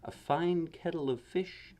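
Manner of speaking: put-on boy's saying. A narrator's reading. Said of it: "often he'd say".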